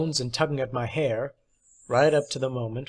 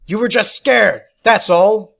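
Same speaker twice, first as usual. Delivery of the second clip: higher, loud shout; furious yell; 7 semitones higher, 11 dB louder than usual. A narrator's reading. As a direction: scream it in his face. Fight continues.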